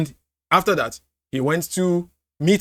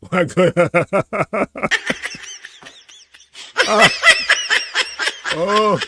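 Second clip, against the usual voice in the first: laughing; 3 semitones higher than usual. Real, not acted. Laughing at clip.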